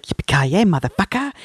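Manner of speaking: growled out like a movie tagline, with swagger; iconic action hero catchphrase. Retold or imitated. Mocking the way the hero won't say his catchphrase: cocky badass line. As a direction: sell it as the classic badass one-liner. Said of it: "he wouldn't say it like".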